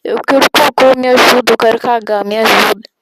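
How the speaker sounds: loud yelled plea; shouting on request. Pretend. Streamer yelling a viewer's requested line, loud. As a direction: yell it out for the chat.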